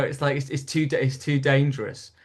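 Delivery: ordinary delivery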